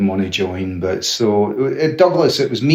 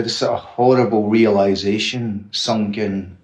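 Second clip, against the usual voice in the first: normal talking voice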